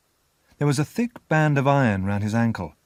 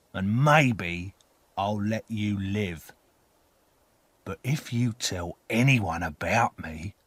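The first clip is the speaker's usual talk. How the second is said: firm orders sinking into slow threat; slow menacing convict. A narrator's reading. Convict swears the boy to silence, slow menace. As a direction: start forceful, then slow down threateningly. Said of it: "the terrible man said slowly".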